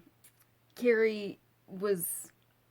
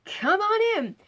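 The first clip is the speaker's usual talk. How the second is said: much higher, louder, bubbly hello; bubbly greeting; 12 semitones higher, 5 dB louder than usual. Retold or imitated. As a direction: greet them all sparkly and bright-eyed. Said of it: "she's really bubbly".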